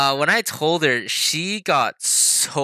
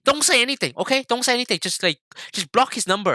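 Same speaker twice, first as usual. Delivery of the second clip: high, urgent mock-parent orders; bipolar mom; 9 semitones higher, faster than usual. Mom on a call telling her son what to say, high and urgent. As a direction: imitate a flustered mom, pitched way up. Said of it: "kept being bipolar and was like".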